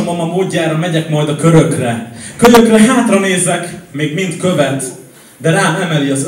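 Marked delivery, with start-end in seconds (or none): 2.4-3.7 loud, raised-pitch slam line; emphatic outburst. Acted. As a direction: throw the line out loud and high. Outburst within slam poem. (hangos, felcsattanó szavalás)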